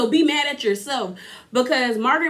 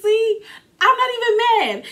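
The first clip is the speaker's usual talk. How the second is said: high, sing-song not even mad; high teasing denial; mock calm; 12 semitones higher, 3 dB louder than usual. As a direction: brush it off in a high mock-sweet voice. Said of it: "I said".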